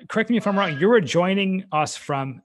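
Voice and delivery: usual speaking voice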